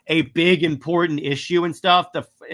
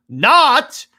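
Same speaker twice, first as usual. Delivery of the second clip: higher, much louder emphatic burst; indignant outburst; 11 semitones higher, 11 dB louder than usual. Real, not acted. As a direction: burst out with a high, loud objection. Indignant objection to the retcon.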